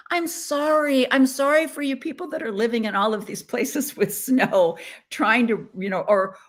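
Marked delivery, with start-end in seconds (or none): 0.1-4.8 high, teasing fake sorry; mock apology. Real, not acted. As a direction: pretend sympathy while gloating. Mock apology to snowy viewers.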